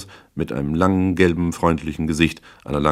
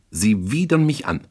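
higher, emphatic revulsion; disgusted scorn; 6 semitones higher than usual. Acted. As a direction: throw your disgust in his face. Client scorns the detective in quarrel.

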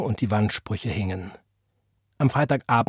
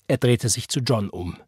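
ordinary delivery, nothing marked

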